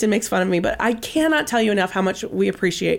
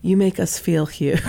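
ordinary delivery

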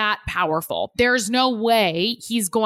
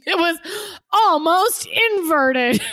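barely holding in a laugh; 6 semitones higher, 4 dB louder than usual. Real, not acted. Giggling over a crude joke.

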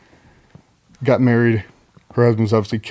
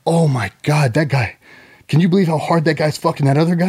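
mock fight commentator; 5 semitones higher than usual. Wife commentating UFC.

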